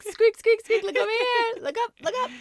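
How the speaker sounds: put-on calling child actors like pets, squeaky and high. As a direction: squeal it like coaxing a puppy. Calling kids like dogs.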